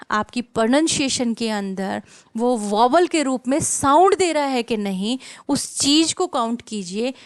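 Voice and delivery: loud, slow, forceful delivery; emphatic teaching. Real, not acted. Teacher driving a golden rule into students' minds, loud and emphatic. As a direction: hammer each word home loudly and deliberately.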